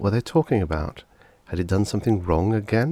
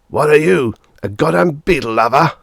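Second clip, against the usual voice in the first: loud, higher, contemptuous yell; furious shout; 9 semitones higher, 9 dB louder than usual. A narrator's reading. Father shouting at his son in disgust, loud. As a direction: lash out at the boy.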